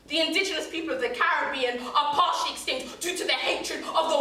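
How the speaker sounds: performed poem rising in fury; impassioned anger. Acted. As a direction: build into a loud, outraged accusation. Poem condemns the killing of people.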